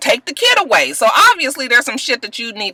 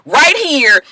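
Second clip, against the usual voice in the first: Screwface going off; 8 semitones higher than usual. Retold or imitated. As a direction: tear into him, pitch climbing. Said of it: "His Screwface was just like".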